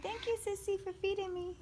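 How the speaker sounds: put-on high, cute baby voice; voicing the baby. Mother voicing the newborn thanking her sister, high baby voice. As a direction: speak for the baby in a tiny high voice. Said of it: "She said".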